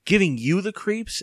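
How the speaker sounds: high, incredulous outcry; crying out. A narrator's reading. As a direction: throw it back in distress. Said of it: "Evan cried".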